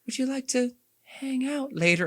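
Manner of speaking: high, airy come-on voice; flirty Liara. Retold or imitated. Fan mocks Liara's flirting, high cooing voice. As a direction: coo it like a shy alien crush. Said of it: "She's like".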